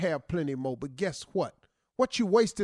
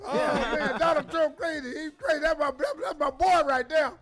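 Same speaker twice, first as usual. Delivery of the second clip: crazy man voice; 8 semitones higher than usual. Pretend.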